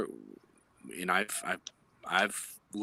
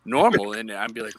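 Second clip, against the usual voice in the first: ordinary delivery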